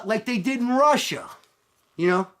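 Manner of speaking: ordinary talk, nothing marked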